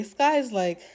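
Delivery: ordinary talk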